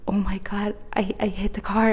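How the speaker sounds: breathy whispered oh my God; whispered panic. Retold or imitated. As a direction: whisper it as if caught. Retelling panic after hitting car.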